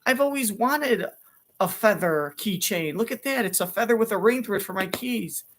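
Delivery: normal talking voice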